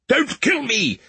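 high, panicked plea for his life; frightened cry. A narrator's reading. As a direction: beg for mercy, voice jumping high. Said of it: "the prince cried".

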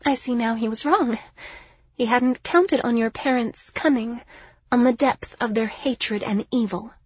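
proud lady making a bitter admission; haughty Liriana, bitter. Acted. Liriana on the parents' hatred, bitter.

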